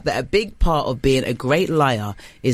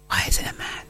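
whispered aside; 6 dB quieter, faster than usual. Real, not acted. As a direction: breathe the question, unvoiced. Aside question during quiz reading.

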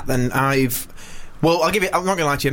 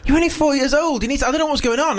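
high-pitched baffled rant, re-enacted; totally confused; 9 semitones higher than usual. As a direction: challenge her in comic disbelief. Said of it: "I was, like, totally confused".

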